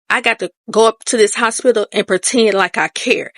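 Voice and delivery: ordinary delivery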